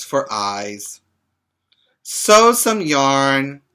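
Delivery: put-on lively read-aloud for little kids, a bit slow in places; reading to children. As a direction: read it out warmly to a group of children. Reads picture book to children.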